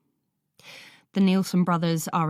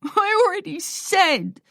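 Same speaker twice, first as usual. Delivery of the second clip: much higher, loud crying wail; 14 semitones higher, 5 dB louder than usual. A narrator's reading. Boy wailing at Kate through tears. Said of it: "Lloyd wails".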